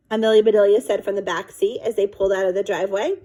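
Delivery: ordinary delivery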